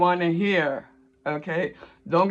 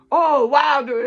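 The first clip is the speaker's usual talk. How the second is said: high and louder wow of fake wonder; mock amazement; 9 semitones higher, 6 dB louder, slower than usual. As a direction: gush like a starstruck friend. Mocking friends' amazement.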